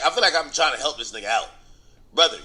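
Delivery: normal talking voice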